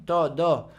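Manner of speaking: "tó, dó" with puckered lips; rounded, forward "tó, dó"; pouted syllables. Shown as a demonstration. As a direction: say "tó, dó" in a small French pout. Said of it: "Ó de biquinho".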